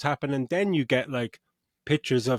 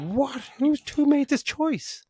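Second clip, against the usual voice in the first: high, slow, stunned "what?"; baffled disbelief; slower than usual. Retold or imitated. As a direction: squeal it out in disbelief, drawn out. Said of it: "I was just like".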